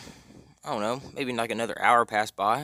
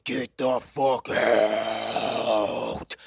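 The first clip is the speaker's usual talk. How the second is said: extremely drawn-out demonic roar; 3 dB louder, much slower than usual. Retold or imitated. Mom ordering the friend out, demon voice. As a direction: stretch it out like an enraged demon. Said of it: "Like some fucking demon".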